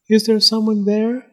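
thin, high faint little voice; faint voice from inside. A narrator's reading. Unseen doll calling from inside the egg, faint high voice. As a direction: call out faintly, pitched high. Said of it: "said a faint voice from inside the egg".

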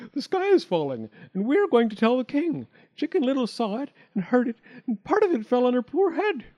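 high, urgent storybook bird voice; Turkey Lurkey. A narrator's reading. Bird telling the fox the sky is falling, alarmed.

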